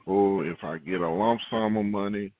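ordinary delivery

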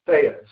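"Theos" said as THAY-us; websites' pronunciation. Shown as a demonstration. Preacher quoting what websites tell you, "Theos" as THAY-us. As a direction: say "Theos" with an AY, set apart. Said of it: "pronounce it as".

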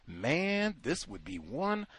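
ordinary talk, nothing marked

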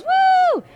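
high, loud held "woo!"; celebratory woo. Acted. Co-host reading listener's congratulations, celebratory high whoop. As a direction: let out a long high cheer.

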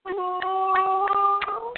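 very high, gushing praise; gushing falsetto. Real, not acted. Listener gushing over a friend's love poem, high squeal. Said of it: "I'm about to cry".

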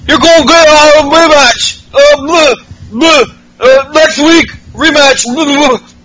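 put-on garbled Becky Lynch accent. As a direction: gargle the words in a silly Becky Lynch accent. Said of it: "Sounds like she has balls in her throat".

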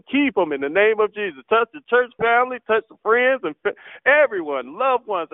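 ordinary delivery

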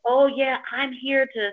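put-on phone conversation; fake phone talk. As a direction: mimic someone staging an obvious phone call. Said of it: "a very obviously fake conversation".

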